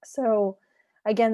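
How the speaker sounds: ordinary talk, nothing marked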